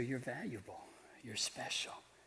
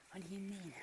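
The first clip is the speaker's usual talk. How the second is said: puppet voice; 8 semitones higher, 10 dB quieter than usual. Acted. Puppet asks in high voice.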